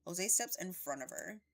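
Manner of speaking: plain reading voice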